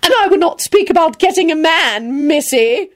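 high, haughty jibe; vicious. A narrator's reading. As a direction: needle her spitefully from up high. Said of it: "she added viciously".

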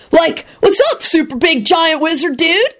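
high-pitched goofy dude voice; friendly. A narrator's reading. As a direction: greet a big pal breezily. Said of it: "in a friendly voice".

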